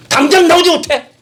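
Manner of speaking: very high, loud angry shout; furious command. Retold or imitated. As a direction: order the child out in a rage. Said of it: "분노하면서 나왔을 겁니다". (분노에 찬 아주 높고 큰 호통)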